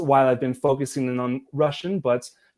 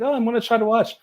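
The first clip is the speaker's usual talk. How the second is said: much higher, eager quoted voice; quoting Stacy; 11 semitones higher, 4 dB louder, faster than usual. Retold or imitated. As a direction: play the friend, lightly and brightly. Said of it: "she's like".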